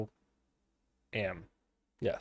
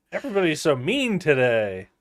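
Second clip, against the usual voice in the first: ordinary delivery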